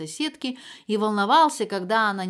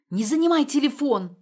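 higher and louder shout; yelling; 6 semitones higher, 8 dB louder than usual. A narrator's reading. Mother yelling at daughter to free the phone. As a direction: yell at her to get off the phone. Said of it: "заорала Инна Владимировна".

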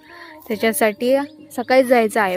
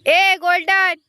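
calling the dog; 8 semitones higher, slower than usual. Real, not acted.

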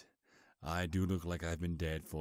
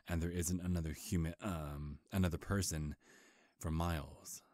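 usual speaking voice

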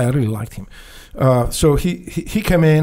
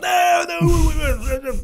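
alarmed shout. Retold or imitated. Guard running up to stop him smashing the window, panicked high shout.

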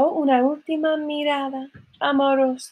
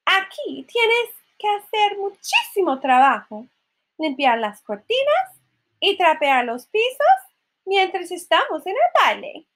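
high, swooping list of orders; bossy stepmother; 8 semitones higher than usual. A narrator's reading. Stepmother giving Cinderella chores, high bossy voice. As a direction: lay down the chores, pitched high. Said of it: "dijo la madrastra".

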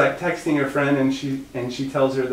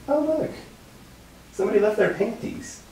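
mock innocent discovery; 11 semitones higher than usual. His retold innocent discovery.

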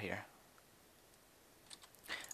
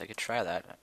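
usual speaking voice

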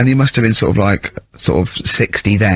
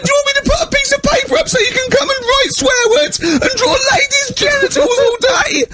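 tearful screaming teacher. Retold or imitated. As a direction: rant through tears, voice breaking high. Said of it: "He went".

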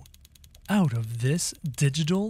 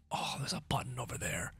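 whisper; 4 semitones lower, 13 dB quieter, faster than usual. Real, not acted. Hiding from security system.

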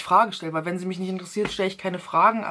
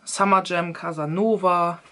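usual speaking voice

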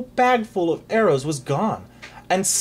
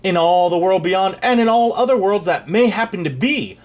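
louder and faster boast, building up; booming warrior king; 5 semitones higher, 5 dB louder than usual. Acted.

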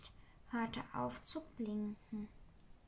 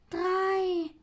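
higher, loud creature count; Sams counting; 7 semitones higher, 9 dB louder than usual. Acted. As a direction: call the number out brightly as the Sams. Sams zählt. (Sams zählt laut und hoch)

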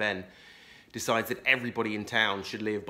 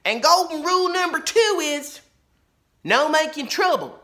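loud and firm; 9 dB louder than usual. A narrator's reading. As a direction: lay down the law. Laura voice.

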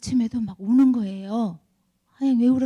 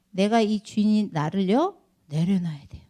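normal talking voice

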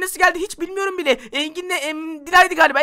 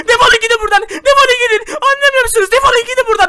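louder and higher, crying while yelling; grieving father screaming at his friends to get out; tearful furious shout; 6 semitones higher, 8 dB louder than usual. Acted. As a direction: drive them out with a sob in the throat.

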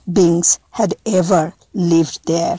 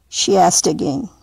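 ordinary delivery, nothing marked

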